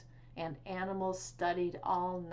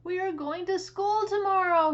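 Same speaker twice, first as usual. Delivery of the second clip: high, loud eager call to the cows; excited announcement; 12 semitones higher, 9 dB louder than usual. A narrator's reading. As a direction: announce it like the best news ever. Farmer Brown announcing excitedly.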